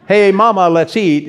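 "Hey, mama, let's eat" with a pause after "mama,"; comma after mama. Shown as a demonstration. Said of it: "is one way of saying".

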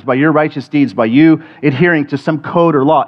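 normal talking voice

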